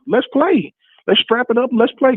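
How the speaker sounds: high, pumped rallying cry; rally the team to play; fired up. Real, not acted. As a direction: pitch shoots up, eager to strap it up. Caller fired up about playing.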